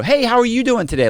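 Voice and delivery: high, bright customer-service voice; overly sweet greeting. Retold or imitated. Ex-con ordering at Starbucks, forcing himself to sound sweet. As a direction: gush it like a too-friendly regular. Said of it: "I'm real overly".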